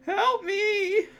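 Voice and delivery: high-pitched mock scream for help. Child crawling up the ramp, screaming out for help. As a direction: wail it way up high like a panicked kid. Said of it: "I was just screaming out".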